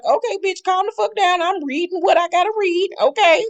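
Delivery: high, exasperated mock scold; Andy losing patience. Mimicking Andy telling Quad to calm down, high and exasperated. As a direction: squeal it out like a fed-up host. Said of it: "And Andy was like".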